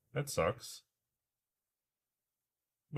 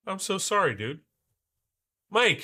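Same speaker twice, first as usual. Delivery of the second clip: usual speaking voice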